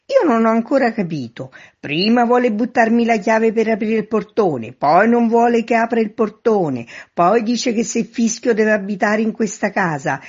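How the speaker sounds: high, loud run of complaint; exasperated calling up. A narrator's reading. Man in street tells neighbour he hasn't understood, exasperated shout. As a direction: complain loudly up at the window.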